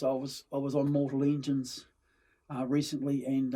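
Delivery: normal talking voice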